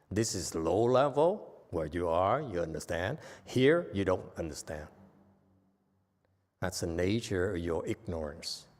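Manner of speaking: ordinary talk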